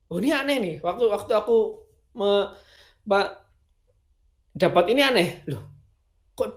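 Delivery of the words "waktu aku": ordinary talk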